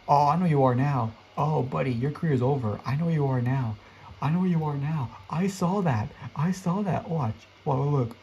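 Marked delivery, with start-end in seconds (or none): none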